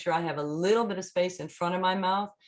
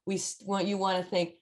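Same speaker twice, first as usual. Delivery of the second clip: ordinary talk, nothing marked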